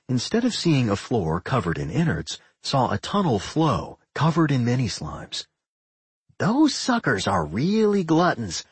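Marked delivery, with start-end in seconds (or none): 6.4-8.6 slow, higher-pitched, mildly amused gloating; snickering. A narrator's reading. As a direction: gloat a little, drawing it out. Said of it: "Doc snickered".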